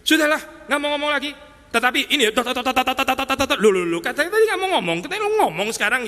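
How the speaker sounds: mock someone who swore off talking; mocking Jesus for talking again after refusing; mood swing. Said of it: "Mood swing dong kayak gini Yesus".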